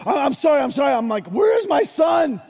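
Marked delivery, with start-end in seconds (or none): 0.1-0.9 higher, hurried scared sorry; a caught stranger blurting apologies; startled apology. Retold or imitated. 1.3-2.4 demanding shout. Retold or imitated. Dad confronts stranger at night.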